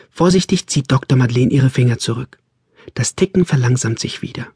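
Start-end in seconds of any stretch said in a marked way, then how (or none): none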